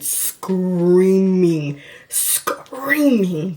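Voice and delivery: "screaming" stretched out long for emphasis; emphatic. Real, not acted. Telling how mom reacted to the onesie, emphatic. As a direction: draw out "screaming" both times, pushing the long vowel. Stressing mom's joyful screaming.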